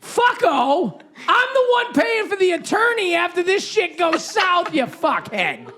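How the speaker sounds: indignant high-pitched yell; outraged dad. Acted. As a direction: shout it like a fed-up father, dragging out the insults. Dad's angry reaction to cheap gift, acted.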